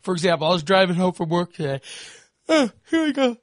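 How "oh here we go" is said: talking through a big yawn, much higher; yawning mid-sentence from exhaustion. Real, not acted. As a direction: let the yawn stretch the words out slowly. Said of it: "that yawn".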